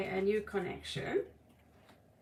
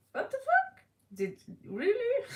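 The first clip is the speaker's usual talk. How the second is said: very high shocked outburst; incredulous; 12 semitones higher than usual. Real, not acted. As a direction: shriek it in disbelief.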